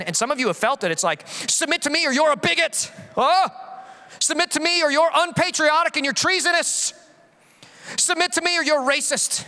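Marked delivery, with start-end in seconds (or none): 1.3-2.9 higher, sneering voice of an imagined accuser; higher, mocking accuser voice; mock bully demand. 3.2-3.5 mock bully. Mocking the culture's demand. 4.2-9.4 higher, sneering voice of an imagined accuser; higher, mocking accuser voice; mock bully demand.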